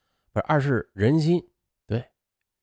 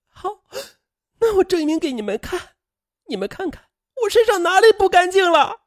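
desperate screaming; 5 dB louder than usual. Acted. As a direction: cry out wildly to prove yourself to your parents. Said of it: "声嘶力竭的喊道". (声嘶力竭地叫嚷，越喊越响)

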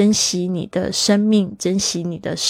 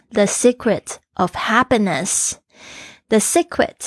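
slow model read; much slower than usual. Shown as a demonstration. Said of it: "注意一下这个secret".

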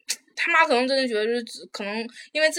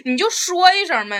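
exasperated plea; 6 semitones higher, 3 dB louder, slower than usual. Retold or imitated. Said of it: "乘务员儿就说".

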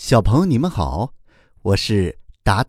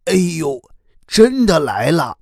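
alarmed; 7 semitones higher than usual. A narrator's reading. Turtle alarmed at pursuers.

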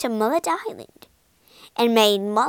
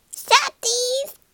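parrot squawk; 12 semitones higher than usual. Acted. Parrot alarm squawks again.

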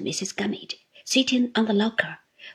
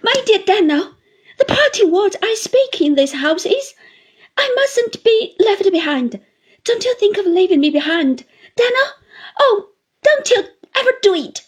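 put-on high, loud, crying plea from an old widow; tearful pleading; 10 semitones higher, 8 dB louder than usual. A narrator's reading. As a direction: beg him through tears, voice breaking. Mrs Gummidge begs not be left.

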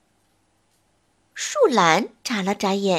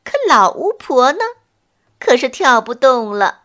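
ordinary delivery, nothing marked

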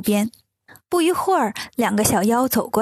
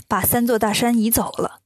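usual speaking voice

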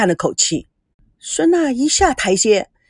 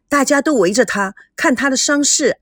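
ordinary delivery

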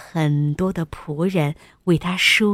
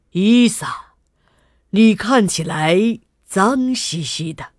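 king; 4 dB louder than usual. A narrator's reading. Visiting king addresses his dirty daughter.

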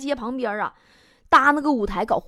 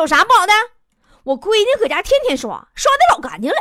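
louder and higher, brash and self-satisfied; 得意洋洋地大声顶回去; 理直气壮的妈妈; 8 semitones higher, 6 dB louder, faster than usual. Retold or imitated. Mother brushing off the manager. (模仿妈妈高声、理直气壮地炫耀)

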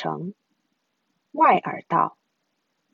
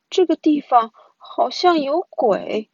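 put-on slow, higher, spooked child; uneasy; 5 semitones higher than usual. A narrator's reading. Brother telling sister the place seems haunted, uneasy. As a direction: draw it out slowly, spooked. Scared brother fears ghosts.

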